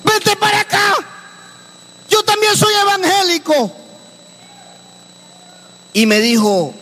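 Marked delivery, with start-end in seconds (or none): 0.0-3.7 llamando a la niña. Retold or imitated.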